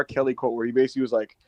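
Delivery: ordinary talk, nothing marked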